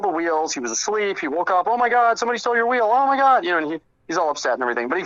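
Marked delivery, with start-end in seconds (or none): 1.7-3.4 higher, louder hammed-up panic; mock alarm. Prankster tells waking friend his wheel was stolen, hammed-up alarm. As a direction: fake shock to sell the prank.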